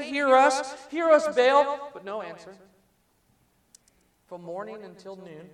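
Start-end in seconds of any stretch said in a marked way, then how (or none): 0.0-1.9 loud, raised, drawn-out plea; pleading call. Acted. Prophets of Baal crying out to Baal, loud drawn-out pleading. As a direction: beg the idol to answer, stretching it out.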